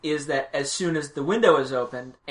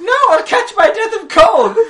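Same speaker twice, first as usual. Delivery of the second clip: put-on high, loud squealing old woman; mock old lady shriek; 11 dB louder than usual. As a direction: shriek it like a fussy old witch. Voicing Adelaide, mock high.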